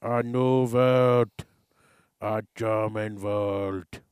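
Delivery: drawn-out mock German proclamation; a slow, grand villain's pronouncement; German accent, very slow. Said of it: "Just joking".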